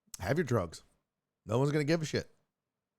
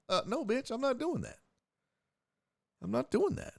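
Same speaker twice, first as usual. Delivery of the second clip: incredulous refusal; 10 semitones higher, 3 dB quieter than usual. Retold or imitated. Refusing in retold scene.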